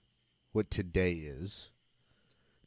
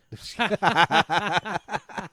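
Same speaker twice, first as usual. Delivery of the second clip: loud, high burst of laughter; big laugh; 9 semitones higher, 6 dB louder than usual. Real, not acted. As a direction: crack up loudly. Teasing about Cody.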